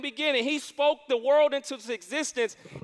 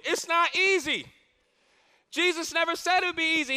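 rising preaching; 6 semitones higher than usual. Acted. Preaching build.

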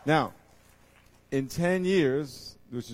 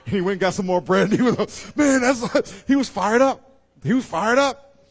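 high and loud, preaching hard; building energy, almost shouting; fired up; 10 semitones higher, 12 dB louder than usual. Real, not acted. Preacher firing up the congregation, loud and high.

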